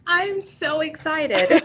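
high, drawn-out, thrilled delivery; real excitement. Real, not acted. New teacher thrilled about her first semester, excited. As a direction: let excitement lift the voice high. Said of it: "change in my voice, it's just purely excitement".

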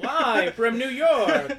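loud, drawn-out TV announcer call; SNL announcer shout. Pretend. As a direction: belt it like the SNL opening. Echoing the SNL opening line.

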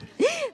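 high, brief amused giggle; real delighted little laugh; short laugh. Real, not acted. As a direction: let out a quick high laugh. Amused that pain obeyed her shouting.

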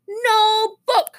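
put-on high childish refusal; high-pitched little-kid protest; stubborn toddler. A narrator's reading. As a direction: refuse like a sulking three-year-old. Toddler keeps refusing the book.

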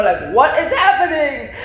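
hammed-up dismay with a laugh in it; retold panic. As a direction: play up the bewildered panic, pitched high. Retold panic over friend's silence.

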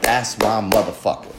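low-key, under the breath; hushed proud remark; soft proud aside. Retold or imitated. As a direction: say it softly to yourself, proud. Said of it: "And I'm like".

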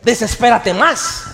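mock exasperation. Mocking being told to calm down.

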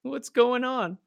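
high, bewildered mock question; confused weirdo voice. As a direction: play the lost newcomer. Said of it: "I was the weirdo being like".

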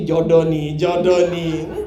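smiling single who hopes it means romance, grinning; grinning teasing. Retold or imitated. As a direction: tease like a smitten single with a wide smile. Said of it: "yang senyumnya lebar kan langsung gini".

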